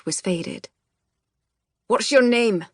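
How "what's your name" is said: sharp, loud bark of a question. A narrator's reading. Gardens boss barking at a new recruit, loud and sharp. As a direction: snap it out like a drill sergeant. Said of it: "he barked".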